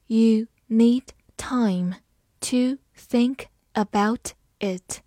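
slow, careful read with a hushed start; slow teacher demo. Shown as a demonstration. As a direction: read it slowly for learners. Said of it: "好，慢慢来读".

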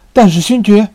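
shouting out in protest; loud protest. Acted. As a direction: burst out, pleading loudly. Felton protests loudly.

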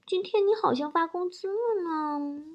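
high, soft, cutesy wheedle; coquettish baby talk. Acted. Wife greets husband on payday, coquettish cooing. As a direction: put on a sugary girly coo. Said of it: "很嗲声嗲气的说".

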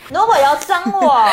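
loud, high playful protest; haggling customer. Pretend. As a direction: tease the auntie not to rip you off. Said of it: "说一遍这个对话".

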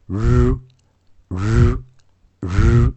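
"日" as a held IPA r, no vowel after it; IPA r sound. Shown as a demonstration. Teacher shows students the IPA sound, bare held r. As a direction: say "日" as a drawn-out buzzing IPA r. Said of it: "它其实比较偏向".

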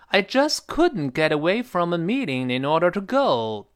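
slow model reading, "just couldn't" lifted, "go" falling; key words stressed. Shown as a demonstration. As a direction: punch "just" and "couldn't", drop on "go". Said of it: "红色单词要重读".

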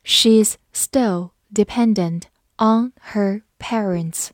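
slow, word by word; slow reading. Shown as a demonstration. Teacher modelling an example about parents, read slowly. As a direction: separate every word clearly. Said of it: "慢读一遍".

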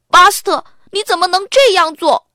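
loud, high furious demand; angry. Acted. Arthur furious at Buster over the secret, loud and high. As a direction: demand an answer, outraged. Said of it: "亚瑟生气极了".

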